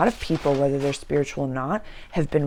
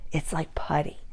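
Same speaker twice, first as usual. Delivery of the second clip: whisper; 5 dB quieter than usual. Acted. Whispered aside.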